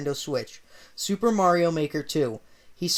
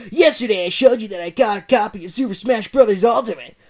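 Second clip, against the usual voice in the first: loud angry accusation; accusing Sonic; 4 semitones higher, 7 dB louder than usual. Acted.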